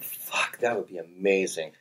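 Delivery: hushed, whispered start; quiet retold relief; awed relief. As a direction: breathe out grateful amazement, softly. Offered water when desperate.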